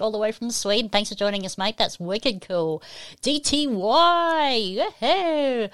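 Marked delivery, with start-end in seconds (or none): 3.2-5.7 high, slow drawn-out call; cheering welcome. Real, not acted. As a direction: stretch the name out and whoop. Cheers a chatroom arrival.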